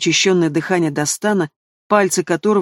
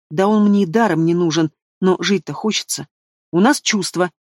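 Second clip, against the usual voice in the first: usual speaking voice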